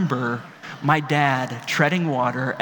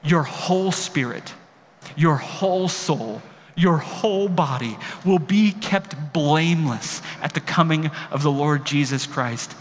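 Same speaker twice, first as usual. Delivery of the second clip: slow, each "whole" leaned on hard; emphatic reading. Acted. Pastor reading Paul's blessing slowly to the church, emphatic. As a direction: press every "your whole" like a promise. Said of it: "do you feel how emphatic he is?".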